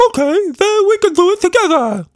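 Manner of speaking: very high, loud squeaky creature, ta-da; triumphant Quatch Monster. A narrator's reading. Creature hanging from the swing, triumphant squeaky shout. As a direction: show off like a proud little acrobat.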